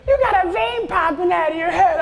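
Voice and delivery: put-on high-pitched kid voice. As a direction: call out like the kid in the third row. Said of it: "He goes".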